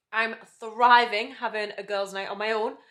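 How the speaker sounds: slow, loud, happy gloat; loud and gleeful. Real, not acted. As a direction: announce it with glee. Gleeful self-declaration.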